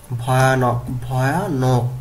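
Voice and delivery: ভয়ানক said carefully as bho-ya-nok; pronunciation drill. Shown as a demonstration. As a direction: model ভয়ানক, splitting the repeat before nok. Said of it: "উচ্চারণ করতে হবে এইভাবে".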